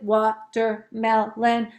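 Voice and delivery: "watermelon" slowly, in four separate beats; counting out syllables. Shown as a demonstration. Teacher counting in the game, "watermelon" in separated syllables. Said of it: "and then we count it".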